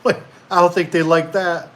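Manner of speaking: half-laughing whoa at a crazy fix; reacting to the neighbor who filled his basement, amused alarm; startled laughing objection. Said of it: "And I'm like".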